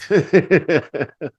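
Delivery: high loud burst of laughter. Real, not acted. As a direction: crack up for real. Laughs at the cartoon.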